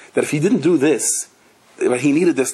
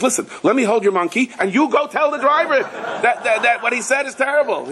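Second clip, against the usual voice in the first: joke bystander; 9 semitones higher than usual. Acted. Man voiced in joke.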